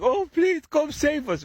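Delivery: high, slow, whiny plea for rescue; hammed-up begging for help; mock pleading. Devil people begging to be saved, in a high mock plea. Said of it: "all these devil people are like".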